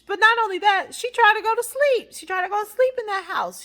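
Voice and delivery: high, loud disbelief; incredulous. Real, not acted. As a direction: exclaim it in high-pitched, scandalized disbelief. Incredulous at character sleeping there.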